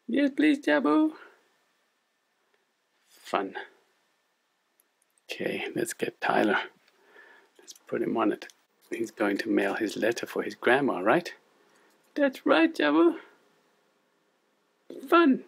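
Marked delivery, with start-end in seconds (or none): 0.1-1.2 squeaky, high cartoon puppet sidekick; chirpy little puppet, much higher. Puppet co-host Lani speaks. 12.2-15.4 squeaky, high cartoon puppet sidekick; chirpy little puppet, much higher. Puppet co-host Lani speaks.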